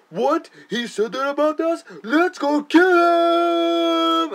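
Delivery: cartoonish soldiers shrieking for revenge; outraged soldiers. Russian soldiers reacting to the insult, outraged shout. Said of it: "they go".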